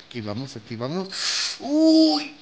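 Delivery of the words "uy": high squeaky nervous "uy"; waiting on a pull, squeaky nervous yelp. Real, not acted.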